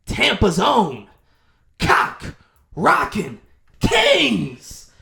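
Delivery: slow, drawn-out loud announcement; milk each word for the crowd; stage emcee. Retold or imitated. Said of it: "He says".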